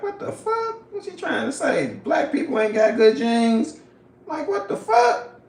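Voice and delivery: high-pitched, incredulous outburst; retold disbelief. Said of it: "I just felt like".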